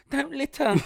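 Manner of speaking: high prim scold; a noble outlaw's tidy rebuke, pitched high. Retold or imitated.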